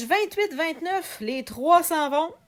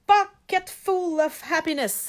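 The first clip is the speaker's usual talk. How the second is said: slow, higher-pitched French-Canadian attempt at an English accent; accent anglais appuyé; 5 semitones higher than usual. Shown as a demonstration. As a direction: show off a homemade English accent, drawn out. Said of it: "mon super accent anglais". (accent anglais appliqué, lent et plus aigu)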